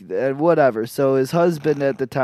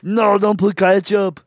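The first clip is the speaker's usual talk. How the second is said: high, slow, loud mock Austrian accent; 6 semitones higher, 7 dB louder, slower than usual.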